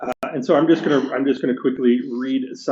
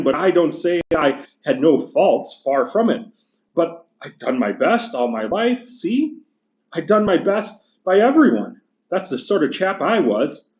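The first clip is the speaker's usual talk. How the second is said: higher, indignant working-class ghost; gruff big ghost; 4 semitones higher than usual. Acted. Teacher reading the ghost complaining to the bright spirit, indignant. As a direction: bluster like an aggrieved ghost.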